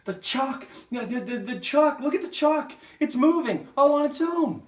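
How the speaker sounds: hysterical, very high, stammering schoolboy; screaming. Acted. Nigel pointing excitedly at the blackboard, screaming. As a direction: shriek the alarm at full volume. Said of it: "and screaming".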